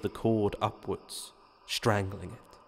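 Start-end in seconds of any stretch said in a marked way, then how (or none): none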